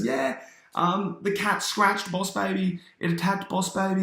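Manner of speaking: high put-on child's voice; kid covering up. Timmy blames the cat to his parents, high-voiced cover story. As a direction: pitch it up like a boy fibbing.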